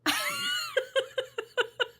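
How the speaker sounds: laughing out in disbelief; shocked laugh. Real, not acted. Paper just ripped unexpectedly.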